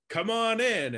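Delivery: high, loud inviting call; cheery invitation. Retold or imitated. Co-host voicing the crew inviting kids in, high and loud. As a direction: call out the welcome brightly.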